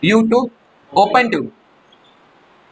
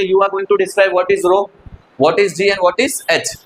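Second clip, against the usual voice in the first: slow dictation; 3 semitones lower, much slower than usual. Shown as a demonstration. Dictating answer to class.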